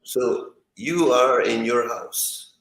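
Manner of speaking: ordinary talk, nothing marked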